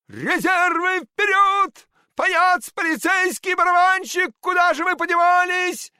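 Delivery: high, loud desperate cry for reserves; desperate shout. A narrator's reading. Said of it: "В отчаянии кричал Щелкунчик". (отчаянный крик)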